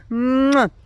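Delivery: loud smacking "mwah" kiss; blown kiss. Real, not acted. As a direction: send the viewers a smacking kiss. Blows viewers a kiss in sign-off.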